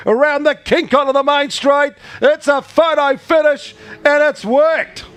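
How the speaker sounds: rising, building excited race call; race caller. Real, not acted. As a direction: call the photo finish, climbing to a shout. Live race finish call.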